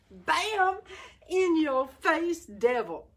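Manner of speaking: high, punchy shout of victory; triumphant taunt. Real, not acted. Preacher taunting the devil in triumph, high and punchy. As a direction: slam it home at the devil.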